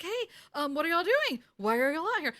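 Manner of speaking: lightly voiced, high and eager Sam. Retelling the episode, Sam's voice goes up high. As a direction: play Sam as a high, nervous intruder.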